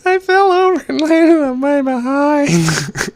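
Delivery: put-on very high, slow, childlike voice; silly cartoon kid reading slowly; Ralph Wiggum. Shown as a demonstration. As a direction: read it like a dopey little boy. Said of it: "I wanna read that like Ralph Wiggum".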